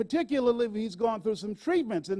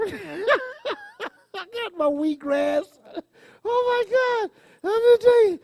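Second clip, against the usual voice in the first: high, slow, crying with laughter; laughing till it hurts; laughing hard; 12 semitones higher, 4 dB louder, slower than usual. Real, not acted. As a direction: squeal through the laughter. Laughing at her supplements.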